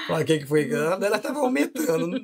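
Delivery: tickled, words bubbling up with chuckles; amused, laughing. Real, not acted. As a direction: laugh helplessly while telling it. Laughing while retelling the odd scene.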